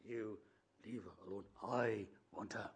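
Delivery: soft, low and menacing; a hushed warning, barely voiced; quiet threat. Nathaniel repeating his quiet warning to Billy. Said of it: "He says it very quietly".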